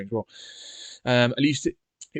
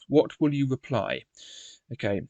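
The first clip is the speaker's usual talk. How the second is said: usual speaking voice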